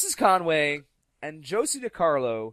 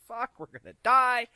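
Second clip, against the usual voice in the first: higher, louder mock panic: "Fuck, we're gonna die"; 10 semitones higher, 5 dB louder than usual. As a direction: play up being scared to death, half joking. Group panicking in retold scene.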